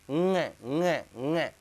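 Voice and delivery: ङ ङ ङ said through the nose; nasal nga repeated. Shown as a demonstration. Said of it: "शुद्ध उच्चारण". (ङ का शुद्ध नासिक्य उच्चारण)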